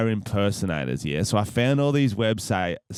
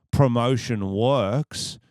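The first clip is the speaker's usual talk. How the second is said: normal talking voice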